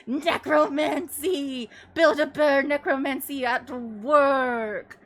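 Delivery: loud, slow, laughing cry of the word; laughing shout. Real, not acted. Streamer repeating her own punchline, laughing loudly. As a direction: howl it while cracking up. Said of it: "laughing repeat of the punchline".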